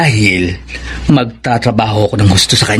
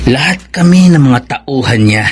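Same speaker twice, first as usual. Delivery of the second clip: normal talking voice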